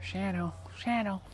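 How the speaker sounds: calling the dog. Real, not acted.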